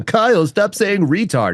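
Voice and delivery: high, drawn-out complainer voice; exaggerated high-pitched telling-off; mock scolding. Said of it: "I had a couple of people".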